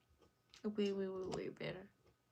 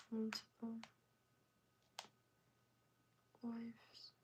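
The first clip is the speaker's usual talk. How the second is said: whispering; 8 dB quieter than usual. Real, not acted. Whispering the questions.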